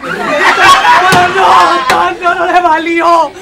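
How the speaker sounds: loud, high melodramatic wailing; hysterical wailing wife. Acted. Wife to doctor, loud high hammed-up wailing. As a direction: howl it like a soap opera widow.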